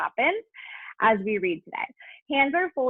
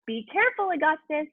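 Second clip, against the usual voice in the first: warning shout; 6 semitones higher than usual. A narrator's reading. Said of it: "shouted Mister Gloop".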